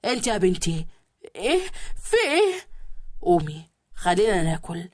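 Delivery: a husband barking her awake; gruff husband. A narrator's reading. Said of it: "وزعق مرة واحدة". (زوج بيزعق بصوت واطي وخشن)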